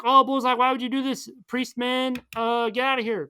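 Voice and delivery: high, loud hammed-up hero cry; hammed-up anguish. Daredevil's climax lines.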